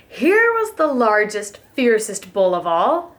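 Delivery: usual speaking voice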